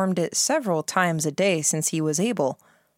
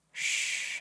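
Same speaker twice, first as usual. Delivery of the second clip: soft whispered hush; murmured shush; 10 dB quieter than usual. A narrator's reading. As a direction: murmur it to silence them. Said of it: "the Baron murmured".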